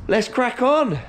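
rally yourself to get going; a burst of energy to kick off the job; rallying cry. Real, not acted. Rallying himself to start.

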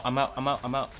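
normal talking voice

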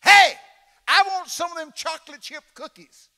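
very loud, high yell for cookies; shouted demand. Retold or imitated. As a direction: bellow it at the passing attendant. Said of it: "here's what I said".

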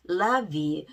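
"Love you" with a clear English V; V pronunciation demo. Shown as a demonstration. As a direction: show learners the V in "Love". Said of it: "V 발음". ("Love"의 V 발음을 또렷하게 시범)

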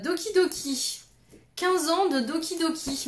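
high, bouncy announcement; playful excitement. Real, not acted. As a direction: chirp the name out with glee. Excitedly announces next find. (annonce enjouée et aiguë)

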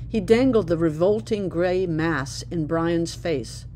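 ordinary delivery, nothing marked

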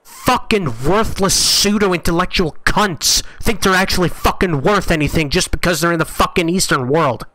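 furious rant. Real, not acted. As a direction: yell it at the manufacturers, boiling over. Streamer raging at broken headset.

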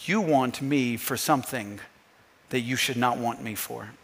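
low, hushed voice of Jesus; soft and low, addressing the heart; quiet rebuke. Acted. Jesus gently rebukes the crowd.

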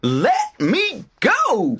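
slow, high-pitched, word-by-word demand; trapped rabbit. A narrator's reading. Rabbit stuck to tar baby, slow high demand.

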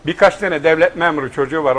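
ordinary talk, nothing marked

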